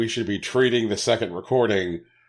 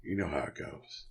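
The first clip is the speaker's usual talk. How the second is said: hungover. Acted. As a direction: speak like the morning after a binge. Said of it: "Sorry, I sound a little hungover".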